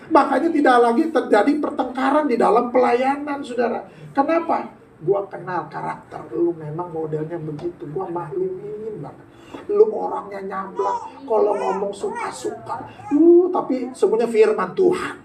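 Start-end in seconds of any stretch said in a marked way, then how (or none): 5.1-12.9 soft understanding aside. Acted. Voicing a believer to a brusque friend.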